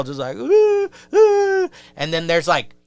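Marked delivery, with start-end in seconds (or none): 0.3-1.7 very high imitated wail; mock him with loud, high crying; mock girlish crying. Mocking a jailed kid, crying like a girl. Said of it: "this dude's crying like a girl".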